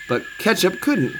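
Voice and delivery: usual speaking voice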